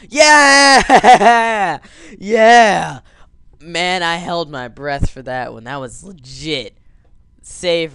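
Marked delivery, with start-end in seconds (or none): 0.1-3.0 loud, high whooping yeah; ecstatic cheer. Real, not acted. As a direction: cheer with a laugh. Celebrating a big landing.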